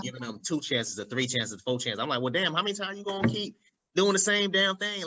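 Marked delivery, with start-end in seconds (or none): 2.2-5.0 higher-pitched, fed-up mock complaint; exasperated retelling, voice climbing high; retold exasperation. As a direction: act out running out of patience, pitched up. Retelling being fed up with repeats.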